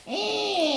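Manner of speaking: put-on tiny squeaky baby sound, very high; baby whimper. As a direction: squeak it like a sad little baby. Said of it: "you see the baby, like".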